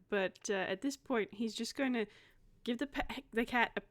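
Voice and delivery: ordinary delivery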